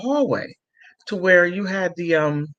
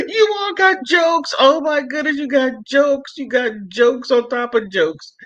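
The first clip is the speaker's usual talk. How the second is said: real amused laughter at the viewers; laughing delight; 9 semitones higher than usual. Real, not acted. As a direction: tease chat while still laughing. Laughing at chat's jokes.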